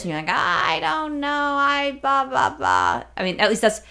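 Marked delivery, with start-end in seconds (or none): 0.3-3.0 high, slow, dopey mimicry of partner; hammed-up impression of a clueless partner, pitched up; over-exaggerated mocking voice; exaggerated imitation. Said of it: "totally over-exaggerating".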